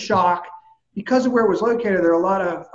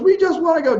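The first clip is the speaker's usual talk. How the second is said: much higher and louder, buzzing with victory; elated locker-room shout; excited friend; 10 semitones higher, 5 dB louder than usual. Retold or imitated. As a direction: blurt it out pumped after the big win. Surgeon excited after game win.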